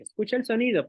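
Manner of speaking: "escucha el sonido" mucho más agudo, como a un niño; imitando a la maestra. Retold or imitated. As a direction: imita a la maestra que dice "escucha el sonido", aguda y alta. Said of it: "no le puedo decir". (maestra que pide "escucha el sonido", voz aguda)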